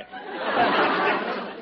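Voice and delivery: short burst of crowd laughter; audience laughter. Real, not acted. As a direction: audience chuckles.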